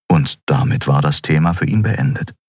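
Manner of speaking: usual speaking voice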